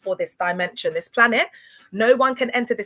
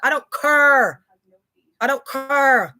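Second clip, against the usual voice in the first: defiant; 3 semitones higher, 5 dB louder, slower than usual. Real, not acted. Brushing off critics, defiant.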